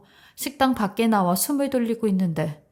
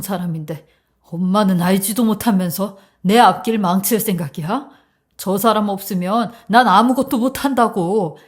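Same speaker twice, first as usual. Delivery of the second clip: heated, insistent reproach; exasperated berating; 4 dB louder than usual. Acted. Son blames his mother for wrecking his future, exasperated. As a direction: plead and scold at once, fed up.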